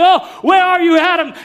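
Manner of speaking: high, loud pleading call. Acted. God as a father calling out to hiding Adam, loud plea. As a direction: cry out to a hiding child. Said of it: "he's calling out and saying".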